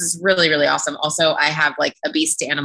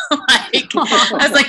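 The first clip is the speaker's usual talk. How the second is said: laughing; 8 semitones higher, slower than usual. Real, not acted. Laughing outburst.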